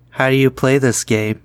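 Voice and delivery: normal talking voice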